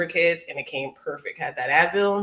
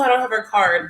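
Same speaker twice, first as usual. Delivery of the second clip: ordinary delivery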